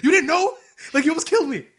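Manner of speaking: high, loud disbelief; imitated outrage. Character confronting girl who almost killed him, incredulous. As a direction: act appalled, pitch shooting up.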